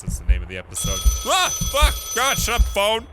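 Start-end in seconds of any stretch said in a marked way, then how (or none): 1.3-3.1 high, loud bursts of irritation; startled, hammed-up outburst; exasperated yelling. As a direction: yell at the thing interrupting you. Yelling at a ringing phone.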